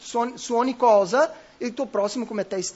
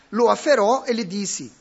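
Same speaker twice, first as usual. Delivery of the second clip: ordinary talk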